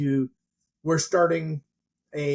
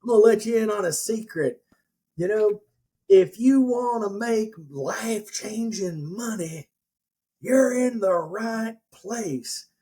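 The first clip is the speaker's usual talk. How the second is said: hint of Southern guru; 6 semitones higher than usual. Seminar guru teasing his crowd, mock Southern preacher voice. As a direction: lay on a touch of Southern drawl, selling hard. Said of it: "he had a little bit of a Southern".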